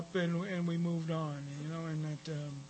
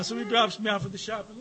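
ordinary talk, nothing marked

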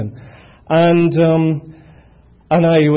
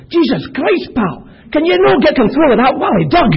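yelling at a neighbour in thick Scottish, much higher and louder; angry Scottish shout; 13 semitones higher, 7 dB louder than usual. Retold or imitated. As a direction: bark it out full Glasgow at the guy with the loose dog. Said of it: "The Scottish terrorists have arrived".

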